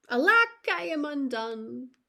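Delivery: sharp high loud cry on Alack, then sinking; anguished cry. Acted. Ruined tailor crying out in despair, high and loud.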